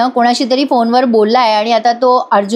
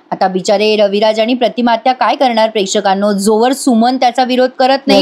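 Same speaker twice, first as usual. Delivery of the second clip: ordinary delivery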